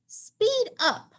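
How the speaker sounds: high squeaky urging; high mouse voice hurrying a friend. Acted. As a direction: squeak it urgently. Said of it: "Marvin squeaks".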